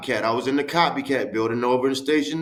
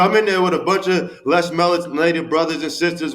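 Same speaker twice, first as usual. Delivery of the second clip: normal talking voice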